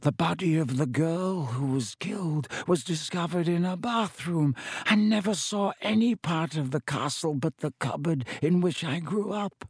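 put-on high, eerie old spider voice; ancient giant spider. A narrator's reading.

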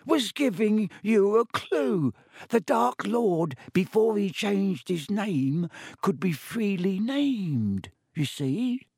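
high, slowed elf voice, matter-of-fact; obvious elf. A narrator's reading. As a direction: explain it like any fool should know. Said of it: "as though this was obvious".

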